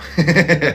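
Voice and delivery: a high, loud burst of laughter; delighted laugh. Real, not acted. Reader laughing at her tarot spread, high and loud.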